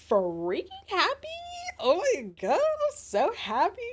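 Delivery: high, excited squeal of joy; gleeful high-pitched gushing; squealing happy. Real, not acted. Cozy cold day joy.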